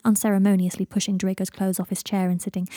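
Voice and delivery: usual speaking voice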